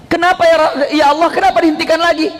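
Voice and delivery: high, hurried questioning; acted high-pitched appeal; puzzled protest. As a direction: ask urgently why, high and quick. Acted protest to God's order.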